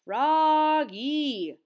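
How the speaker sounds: long, loud, high call; teacher's cry. A narrator's reading. Teacher interrupting Froggy's loud reading, loud cry. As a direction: cry the name out to get attention. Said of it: "cried his teacher".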